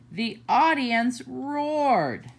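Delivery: ordinary delivery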